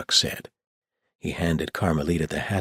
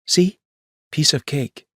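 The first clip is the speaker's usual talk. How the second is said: put-on higher, slightly louder girl's reply; teen girl voice; 11 semitones higher, 6 dB louder than usual. A narrator's reading. Carmelita reassuring Rosie, cool higher girl's voice. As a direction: answer coolly to reassure her sister. Carmelita after practice swing.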